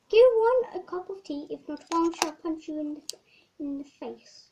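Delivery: bossy queen demanding tea; posh Queen voice. Pretend. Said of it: "posh voice".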